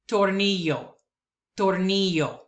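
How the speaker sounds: tornillo as tor-NI-yo, double l like y; tornillo, stress on NI; Spanish word. Shown as a demonstration. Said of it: "words that I will pronounce".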